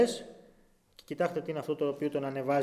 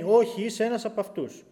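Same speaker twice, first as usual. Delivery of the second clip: a hint of the insistent servant girl, pushing; insistent accuser; 6 semitones higher, 5 dB louder than usual. Servant girl insisting Peter is one of them, pushy. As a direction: press the accusation on him. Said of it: "Αυτή η γυναίκα επιμένει".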